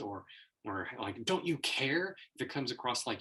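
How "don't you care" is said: higher, louder guilt-trip question; hammed-up guilt trip; mock pleading accusation. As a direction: lay the guilt on. Mock accusations thrown at speaker.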